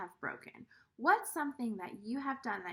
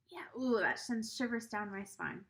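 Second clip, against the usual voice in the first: ordinary delivery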